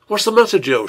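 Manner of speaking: high, loud anxious cry; alarmed mother rushing to the bed. Acted. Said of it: "she asked anxiously".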